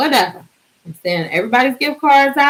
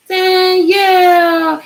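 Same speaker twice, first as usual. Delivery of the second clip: excited announcement; 8 semitones higher, 6 dB louder, much slower than usual. Real, not acted. Announcing a gifted card.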